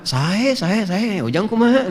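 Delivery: a casual Sundanese greeting, lightly voiced; hint of a Sundanese village elder; Sundanese reply. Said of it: "Apa kabar, Pak?".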